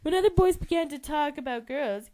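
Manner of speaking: usual speaking voice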